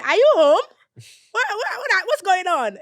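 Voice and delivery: high, very loud bewildered questions; startled dad. Retold or imitated. Dad seeing his daughter move back home, startled. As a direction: catch him off guard.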